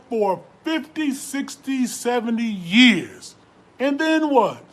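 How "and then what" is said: And then what flung out higher and louder; challenging demand. Acted. Preacher to listeners, loud challenging question. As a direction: hurl the question at the crowd. Sermon hammering the point.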